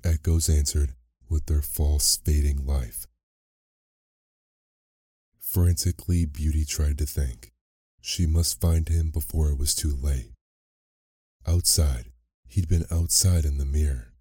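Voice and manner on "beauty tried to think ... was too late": plain reading voice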